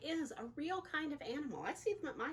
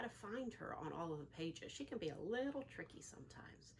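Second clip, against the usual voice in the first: hush, let them in on the trick; playful whisper to the children; secretive whisper; 7 semitones lower, 7 dB quieter than usual. Acted. Teasing kids about hidden Heidi.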